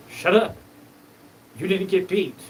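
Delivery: a hammed-up snap to shut him up; retold angry shout. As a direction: yell it back at him. Snapping back in retold argument.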